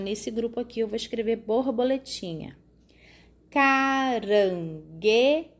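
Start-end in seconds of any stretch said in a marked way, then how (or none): 3.5-5.5 "Caranguejo" drawn out slowly, ca-ran-gue-jo; syllable by syllable. Shown as a demonstration. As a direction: pull "Caranguejo" apart one syllable at a time. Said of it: "Quatro também".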